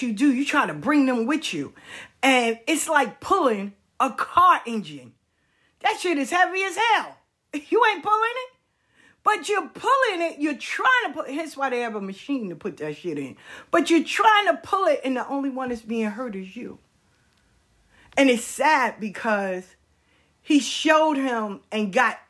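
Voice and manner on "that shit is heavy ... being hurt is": exasperated. Real, not acted. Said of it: "comic exasperation".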